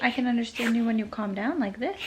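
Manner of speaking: soft, patient reassurance; calming. Real, not acted. Mother explaining to crying toddler, soft calming voice.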